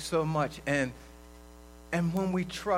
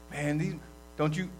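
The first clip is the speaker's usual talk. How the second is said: usual speaking voice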